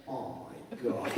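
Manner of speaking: breathless whispered oh my God; whispered awe. Retold or imitated. Whispering in awe at the massive spider. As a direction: hush it out in shocked awe. Sees the huge spider up close.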